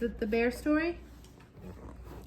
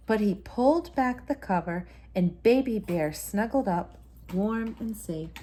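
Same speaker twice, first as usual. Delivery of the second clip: story narration; 3 semitones lower, 6 dB louder than usual. A narrator's reading. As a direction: tell it like a bedtime story to a child. Bedtime story narration continues.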